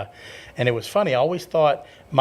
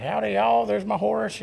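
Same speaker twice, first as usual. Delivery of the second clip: high, exaggerated Southern twang; mock Southern drawl for laughs; hammed-up southern drawl; 9 semitones higher than usual. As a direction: ham up a Texas cowboy greeting. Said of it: "the southern drawl comes back".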